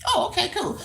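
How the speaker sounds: usual speaking voice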